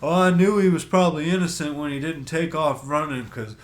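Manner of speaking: high, loud mock-dopey officer; childish cop. Officer explaining his hunch about suspects, mocking childish voice. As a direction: mimic a cop who sounds like a little kid. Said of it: "You sound like a fucking three-year-old".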